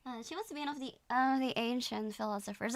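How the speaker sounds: ordinary delivery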